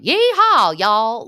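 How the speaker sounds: loud, high, full-blown Southern twang; hammed-up Southern cowgirl holler; Sutton. Mocking Sutton's thickening accent, exaggerated Southern drawl. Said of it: "she's just gonna be full-blown".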